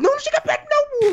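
high-pitched, urgent plea; alarmed warning. Real, not acted. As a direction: warn a friend off in alarm. Warning teammate away from rock. (aviso agudo e alarmado)

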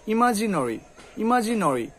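ইমাজিনারি ending in rounded "no-ri"; correct pronunciation. Shown as a demonstration. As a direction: round the vowel before ri in ইমাজিনারি. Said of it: "এই যে নরি".

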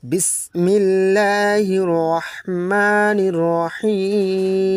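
slow, chanted Bismillah, lifted and drawn out; Quran recitation. Acted. Teacher begins the surah in chant. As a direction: intone it slowly like opening a recitation.

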